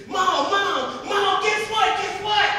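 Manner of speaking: very high, loud, bursting with news; acted overjoyed boy yelling; excited shouting. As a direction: call out to mother in breakless excitement. Boy runs home with great news.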